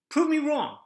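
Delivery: high, loud dare to prove him wrong; daring anyone to contradict him; defiant challenge. Real, not acted. Challenging the audience, emphatic.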